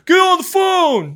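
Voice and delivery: high, drawn-out parody shout; mock boss yell. Mocking a boss who yells at his people, exaggerated yell. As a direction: yell it loud and slow, way up in pitch. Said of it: "Don't yell at your people all the time".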